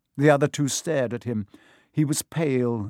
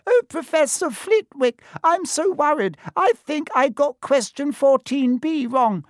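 very high, loud mocking swot voice; mock-worried falsetto; put-on high voice; 5 dB louder than usual. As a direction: squeak it out like an anxious pupil, hammed up. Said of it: "He put on a high voice".

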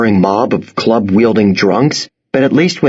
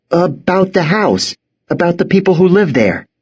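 put-on nervous boy; 8 semitones higher than usual. Boy answers at knifepoint.

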